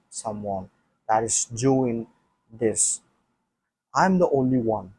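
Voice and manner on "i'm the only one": ordinary talk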